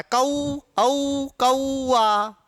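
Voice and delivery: "कौ, औ, कौआ" drawn out with a-oo; slow old-style glide to oo in कौ and औ; old pronunciation. Shown as a demonstration. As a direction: stretch कौआ as ka-oo-aa. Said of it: "यूं बोलते थे हम".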